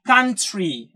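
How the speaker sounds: "കൺട്രി" said with the tr turning to chr, "kun-chree"; ch-sound country. Shown as a demonstration. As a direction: push the tr towards ch. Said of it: "pronunciation വരുന്നത്".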